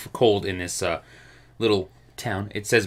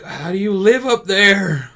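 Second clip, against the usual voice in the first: high, slow, loud wail; hammed-up incredulous cry; mock amazement; 11 semitones higher, 7 dB louder, slower than usual. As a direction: imitate astonished outsiders, stretched out. Imitates amazed Americans.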